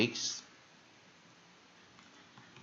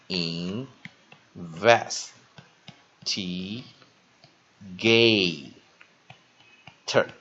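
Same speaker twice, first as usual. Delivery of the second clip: "investigator" broken into slow, separate syllables with gaps; 2 semitones lower, 4 dB louder, much slower than usual. Shown as a demonstration. Teacher breaking a word down for students, "investigator" syllable by syllable. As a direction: sound out "investigator" piece by piece, very slowly. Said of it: "好，再一遍".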